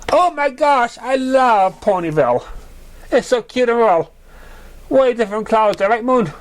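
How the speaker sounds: high, gushing excitement; an excited high mare's voice. Acted. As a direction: squeal over how cute it is. Berry Cake gushing.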